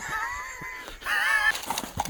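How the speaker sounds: high gleeful cackle; high cackling laugh. Real, not acted. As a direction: cackle at the friend. Laughing at his own tease.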